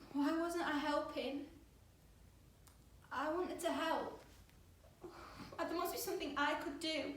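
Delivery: desperate. Acted. Girl watching the injured, unable to help, desperate. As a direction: plead to be allowed to act.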